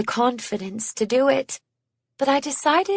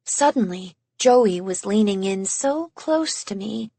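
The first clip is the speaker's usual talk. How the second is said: plain reading voice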